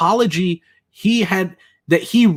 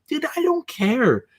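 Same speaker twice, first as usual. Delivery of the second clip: exasperated; 8 semitones higher, slower than usual. Retold or imitated. Retold dismissal of Doug.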